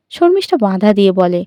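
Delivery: ordinary delivery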